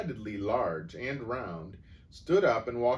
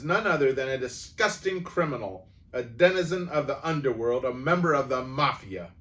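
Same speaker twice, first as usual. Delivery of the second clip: higher, louder scornful rant; furious tirade; 5 semitones higher, 8 dB louder than usual. A narrator's reading. Headmistress insulting the boy before the school, furious. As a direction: spit each insult louder.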